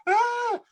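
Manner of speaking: very high imitated shriek; a sudden mock cry of alarm; mock triggered scream. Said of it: "it could trigger her and go".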